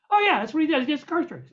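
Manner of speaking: cheerful producer. Retold or imitated. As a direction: toss it off cheerfully.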